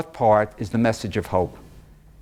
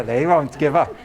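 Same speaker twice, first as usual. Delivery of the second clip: ordinary talk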